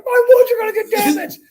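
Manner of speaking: high, loud fussy mock whine; whiny golfer impression. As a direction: squeal it like a precious golfer fretting over his clubs. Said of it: "he'd get all like".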